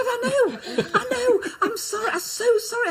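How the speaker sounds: high, breathless apology with a laugh; apologetic young self. Retold or imitated. Young actress apologising to Olivier in a high voice. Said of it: "I said".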